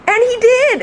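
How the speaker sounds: high, loud exclamation; amazed storytelling. Real, not acted. Storyteller amazed at burglar getting down, excited exclamation. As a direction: marvel loudly that it worked.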